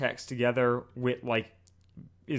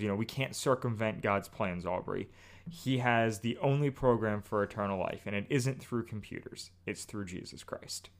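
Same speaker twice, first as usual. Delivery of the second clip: gentle sermon; 4 semitones lower, 6 dB quieter than usual. Retold or imitated. Wit's gentle sermon, retold.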